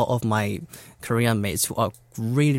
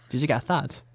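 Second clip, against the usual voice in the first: ordinary talk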